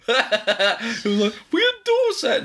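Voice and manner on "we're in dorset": very high, playful retold squeal; mock exclamation. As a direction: mimic an over-excited traveller up high. Retelling confusion on motorway drive.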